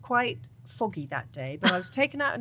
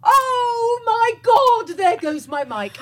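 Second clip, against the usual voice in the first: high, slow, loud panicked cry; panicked wail; 13 semitones higher, 9 dB louder, much slower than usual. Retold or imitated. Alone at sea, crying out as the mic fails, panicked wail.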